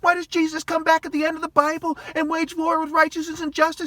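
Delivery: high, loud, heated outburst; furious rant. Real, not acted. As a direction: let the anger boil over. Ranting rising fury.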